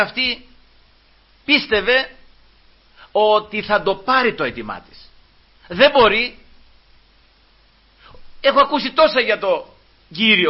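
high, insistent voice of conviction; fervent preaching. Acted. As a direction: preach her faith with lifted, measured phrases. Preaches, voicing the woman's faith.

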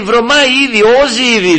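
high, loud alarmed warning; retold sister crying out, pitched high; Lazarus's sister pleading. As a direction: stop the Lord in dismay. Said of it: "λέει".

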